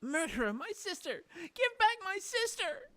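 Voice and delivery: high, loud, tearful demand; grief-stricken scream. Acted. Grieving girl screams at Faye, furious and tearful. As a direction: scream for her lost sister.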